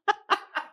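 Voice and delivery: brief high-pitched giggle; giggle, a little embarrassed; high nervous giggle. Real, not acted. Awkward laugh at own question.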